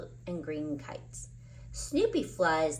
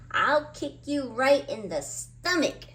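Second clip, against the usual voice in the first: loud threat, raised high; yelling at tree; 6 semitones higher, 5 dB louder than usual. A narrator's reading. Charlie Brown yells at the kite-eating tree, loud. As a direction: yell it right at the tree. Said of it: "yells at the tree".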